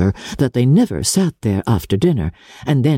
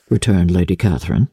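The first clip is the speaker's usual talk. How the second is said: ordinary delivery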